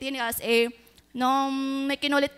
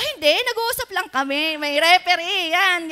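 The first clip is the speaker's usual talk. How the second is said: grandpa's reply; 6 semitones higher, 8 dB louder than usual. Retold or imitated.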